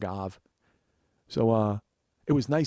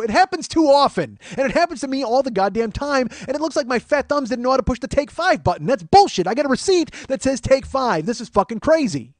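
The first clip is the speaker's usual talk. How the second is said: high, loud indignant rant; frustrated outburst; 7 semitones higher, 5 dB louder than usual. Retold or imitated. Man insisting to her it wasn't his mistake, loudly frustrated. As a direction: argue back heatedly with pitch raised. Argues the mistake happens too often.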